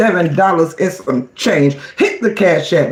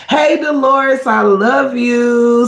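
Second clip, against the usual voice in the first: slow, stretched sweet greeting, pitched up; drawn-out sing-song greeting; 5 semitones higher, slower than usual. Real, not acted. Streamer greeting a chat member with a slow, stretched sing-song. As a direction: draw out each word like a loving call. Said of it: "I don't know why I started talking like that".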